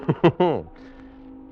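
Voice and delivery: a short hearty laugh at a friend's joke; amused laugh. Acted. First mate laughing at the cook's remark, amused.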